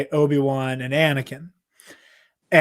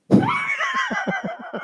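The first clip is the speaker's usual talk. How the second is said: squealing laugh; sudden high shriek of a laugh; high burst of laughter; 5 dB quieter than usual. Real, not acted. Laughs at own joke.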